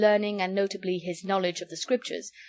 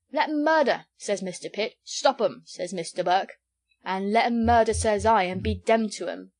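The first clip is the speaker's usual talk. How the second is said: louder, emphatic mock of politicians; 4 dB louder than usual. A narrator's reading. Hempseed mocking Pitt and Burke to Jellyband, emphatic. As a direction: bang out each quote firmly.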